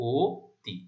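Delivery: ও তি in two separated syllables, rounded "O... ti"; closed o vowel. Shown as a demonstration. Teacher applying the rule for students, ও তি as "O... ti". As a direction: split ও তি, holding a closed "o" first. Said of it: "আদ্য অ এর উচ্চারণ ও এর মতো হবে".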